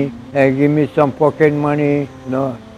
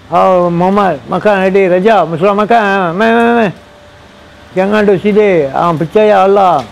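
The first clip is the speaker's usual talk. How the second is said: lightly voiced Malay friends, higher and a little louder, calling him to eat; imitating friendly Malay neighbours, higher and louder; 6 semitones higher, 3 dB louder than usual. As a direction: call out kindly, like inviting someone over to eat. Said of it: "they say".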